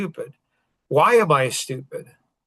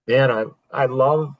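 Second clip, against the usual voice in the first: ordinary talk, nothing marked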